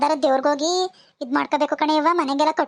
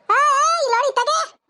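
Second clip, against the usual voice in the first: calling out; 10 semitones higher, slower than usual. Acted. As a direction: hail her from a distance. Calling neighbour from afar. (ದೂರದಿಂದ ಜೋರಾಗಿ ಕೂಗುವುದು)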